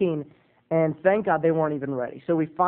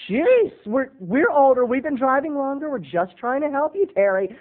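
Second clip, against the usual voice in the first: higher mock parents; 8 semitones higher than usual. Parents justifying their nagging, higher mock voice. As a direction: defend their advice with a sigh. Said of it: "they were like".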